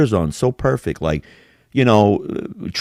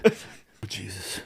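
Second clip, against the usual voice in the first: breathy, much softer sigh of despair; hushed mock despair; 14 dB quieter than usual. Mocking Batista's crying scene, hushed. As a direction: let it fall to a hush. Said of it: "And he's like".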